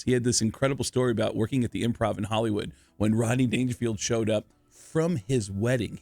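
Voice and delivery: ordinary talk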